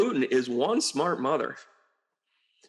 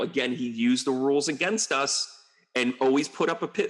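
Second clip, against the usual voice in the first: ordinary talk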